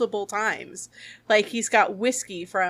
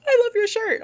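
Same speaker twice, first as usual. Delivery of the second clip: much higher voice for a passing fan; imitated admiring stranger; 14 semitones higher, 4 dB louder than usual. Stranger complimenting a fan's shirt, high imitated voice. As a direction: pipe it up high, mimicking a compliment. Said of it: "somebody's like".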